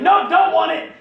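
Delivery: high, loud frantic no; panicked refusal. Retold or imitated. Zaktan dropping the cursed mask, panicked shout. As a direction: fling the words away in a fright. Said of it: "and he's like".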